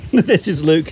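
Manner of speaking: burped out through a belch; burping the words. Real, not acted. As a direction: say the name while burping. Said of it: "who just burped out his name".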